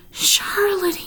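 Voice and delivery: high, drawn-out anguished moan. A narrator's reading. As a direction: moan her name in grief. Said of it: "he moaned".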